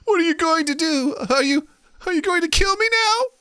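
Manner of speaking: very high squeaky put-on voice; panicked falsetto captive; frightened vampire victim. Captive vampire asks the sorcerer about his fate, high and frightened.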